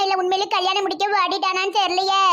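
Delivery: ordinary talk, nothing marked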